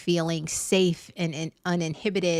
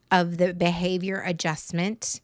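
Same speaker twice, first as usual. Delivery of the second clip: ordinary talk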